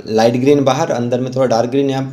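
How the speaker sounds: ordinary delivery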